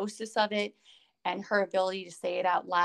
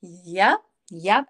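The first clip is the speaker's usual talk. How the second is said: loud, punchy yep; emphatic agreement; 9 dB louder than usual. Retold or imitated. To the co-host, relating to Selena, a loud yep. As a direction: hammer the yeps home like it's too true.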